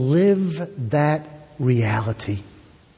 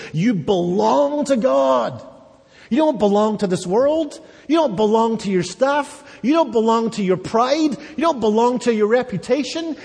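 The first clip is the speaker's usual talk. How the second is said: rising, faster preacher's climax, pitch climbing higher; preaching at full force; 9 semitones higher than usual. Acted. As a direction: drive each "You don't belong" up like a crescendo.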